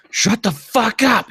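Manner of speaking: high drawn-out yell. A narrator's reading. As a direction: yell it in your head. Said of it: "he yelled in his own head".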